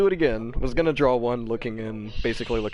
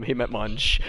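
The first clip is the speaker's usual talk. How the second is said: ordinary talk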